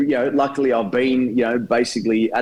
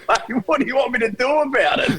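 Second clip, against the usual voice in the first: much higher, put-upon, with a laugh; mock shrug reply, laughing; 14 semitones higher than usual. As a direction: toss it back helplessly, laughing. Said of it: "sitting up there going".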